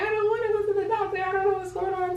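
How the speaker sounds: very high, fast mock wail; squeaky pretend-crying protest; imitated crying. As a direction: whine it like a tearful child, hammed-up. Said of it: "I start crying. I'm like".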